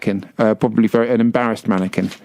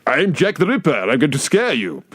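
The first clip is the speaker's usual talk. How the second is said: hammed-up waxwork villain, slowing at the end; mock-menacing leap-out scare; scary Jack the Ripper. Waxwork actor leaping out at tourists, hammed-up menace. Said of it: "I used to leap out and go".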